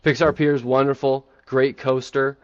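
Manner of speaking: usual speaking voice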